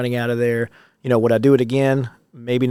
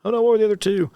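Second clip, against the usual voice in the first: flustered; 10 semitones higher, faster than usual. Real, not acted. Forgetting his list mid-answer.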